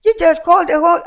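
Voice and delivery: high, loud mimicked reply; retold vendor's reply. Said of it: "And she said".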